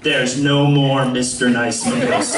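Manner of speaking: low, slow, stern teacher; teacher impression. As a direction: drag it out like a mock-stern teacher. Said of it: "he turned to us and he said".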